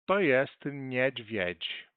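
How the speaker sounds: "niedźwiedź" stretched out, the final "dź" clearly sounded; slow Polish model. Shown as a demonstration. Teacher stretches "niedźwiedź" slowly for students. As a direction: take it very slowly and land the "dź". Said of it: "acentuado com a cresca".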